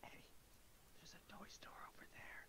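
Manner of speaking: hushed unvoiced whisper between companions. Two friends sneaking off, whispering. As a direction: lean in and whisper the plan. Secret aside between characters.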